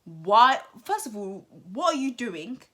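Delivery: put-on a sudden loud "Girl" then a sharp "Why?"; exasperated loud reaction to Liberty; loud sassy reproach. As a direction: call her out loudly like a big sister. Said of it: "And I was like".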